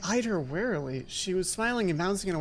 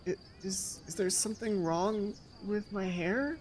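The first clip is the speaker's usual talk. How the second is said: soft, slow, nervous question; timid and hurt; 2 semitones higher, 6 dB quieter than usual. Acted. New girl nervously asks roommate about her hair, soft and slow. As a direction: ask quietly, afraid of the answer. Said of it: "My hands began to tremble".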